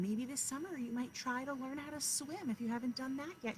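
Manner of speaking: usual speaking voice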